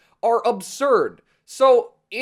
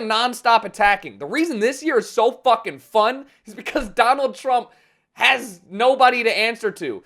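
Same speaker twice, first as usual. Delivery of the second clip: ordinary talk, nothing marked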